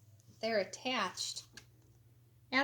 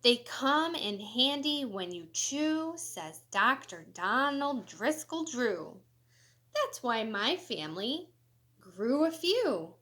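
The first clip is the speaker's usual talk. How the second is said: lively, sing-song storybook reading; animated picture-book read-aloud; reading to children; 2 semitones higher, 5 dB louder than usual. Acted. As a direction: read it bright and bouncy for kids. Reads quotes from characters.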